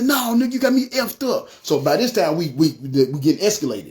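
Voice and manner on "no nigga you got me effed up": retold yelling. Accused robber arguing back at storyteller, mild mock yell. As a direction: imitate an angry man's protest, pitched high. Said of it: "So he's yelling".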